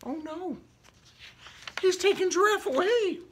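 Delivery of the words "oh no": ordinary delivery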